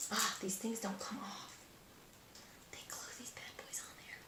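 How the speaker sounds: whispered aside; whispering. Real, not acted. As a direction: whisper it under your breath. Muttering aside.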